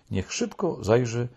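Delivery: plain reading voice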